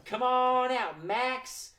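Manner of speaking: sing-song summons, drawn-out vowels; calling out. Shown as a demonstration. Host leads the children calling Max the cat, calling out. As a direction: call the cat out to play. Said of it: "Let's call out Max the cat".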